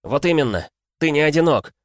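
high, loud joyful cry; excited shout. A narrator's reading. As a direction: burst out with sudden joy. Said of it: "обрадовался Игрорыцарь".

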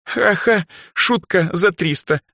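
high, fake ha-ha then slow sarcasm; strained mock laugh, high-pitched; forced laugh. A narrator's reading. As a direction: laugh stiffly at a bad joke. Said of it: "натянуто рассмеялась Даяна".

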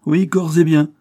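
répète plus haut et plus fort, en criant; martèle la malédiction à pleine voix; menace criée. Acted. Sorcière répète sa prophétie. (la vieille crie sa prophétie au capitaine, menaçante)

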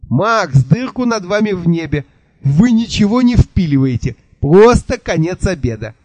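high, fast babble with a burred R; burred rapid chatter. A narrator's reading. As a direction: rattle it off breathlessly, swallowing the R. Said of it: "Картаво затараторил он".

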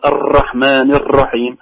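"الرحمن الرحيم" with heavily repeated r; slow, roll each ر hard; over-trilled r. Retold or imitated. Teacher imitating excessive repetition, strongly trilled r in "الرحمن". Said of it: "يكررها تكرار شديد".

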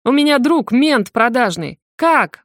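slow, loud, bitter and wounded; hurt friend raging; angry and hurt. A narrator's reading. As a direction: spit it out angry and betrayed. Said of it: "В тоне и злость, и обида".

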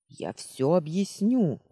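loud, slow exclamation; emphatic, drawn-out promise to explain; urgent protest. A narrator's reading. Caught intruder protests to the heroine.

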